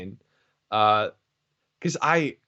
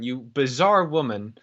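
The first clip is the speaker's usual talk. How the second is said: usual speaking voice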